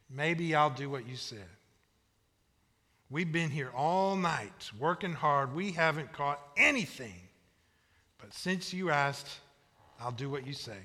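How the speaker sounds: low, grudging agreement; worn-out fisherman reluctantly giving in; reluctant grumbling. Acted. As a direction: grumble wearily, then give in softly. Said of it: "He kinda grumbles".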